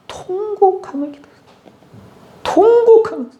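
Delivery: high, strained wailing voice; mock wailing. Shown as a demonstration. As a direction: wail it like a grieving king. Preacher demonstrates wailing prayer. (통곡하듯 높게 울부짖는 목소리)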